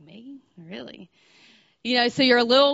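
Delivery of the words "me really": barely above a whisper, shy and amazed; a hushed, bashful "me, really?"; shy disbelief. Retold or imitated. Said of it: "and you're just like".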